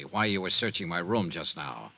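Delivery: ordinary talk, nothing marked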